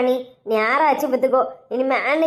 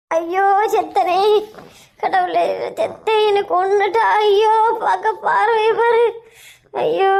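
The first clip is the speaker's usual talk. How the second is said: slow, drawn-out mock wail; hammed-up cry of dread; panicked wailing; 3 semitones higher, slower than usual. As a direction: moan as if done for. Acted wailing at being caught out.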